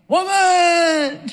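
high, loud drawn-out yell imitating a husband; mimicked husband yelling. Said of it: "it's always, "Woman!".

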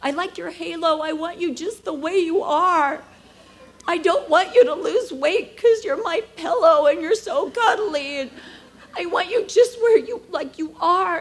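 a real cry breaking through the quote; crying while quoting. Real, not acted. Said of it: "when I told him".

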